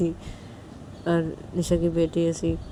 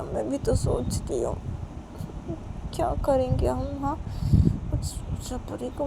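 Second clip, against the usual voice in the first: high fretful whine; 7 semitones higher than usual. Acted. Nisha anxious pleading.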